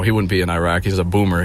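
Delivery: ordinary delivery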